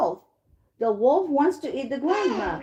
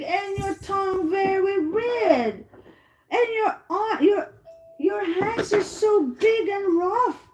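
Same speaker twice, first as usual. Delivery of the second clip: put-on surprised little girl; 7 semitones higher than usual. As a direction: act a child's wondering surprise, voice held high. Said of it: "So she was surprised".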